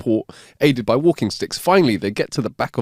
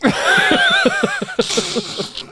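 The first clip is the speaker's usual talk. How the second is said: high wheezing laugh. Real, not acted. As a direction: burst into a squealing laugh. Laugh after punchline.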